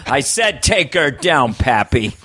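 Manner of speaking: slow, flat-out commanding drawl; John Wayne. Retold or imitated. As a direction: refuse to hear it, slowly. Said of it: "John Wayne".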